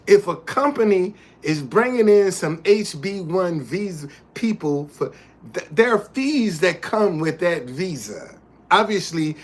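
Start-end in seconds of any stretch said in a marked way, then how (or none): none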